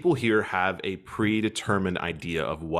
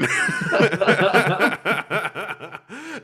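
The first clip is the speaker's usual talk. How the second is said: loud high laugh; 10 semitones higher, 6 dB louder than usual. Real, not acted. Laughter after quote.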